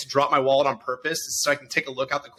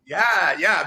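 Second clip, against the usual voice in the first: usual speaking voice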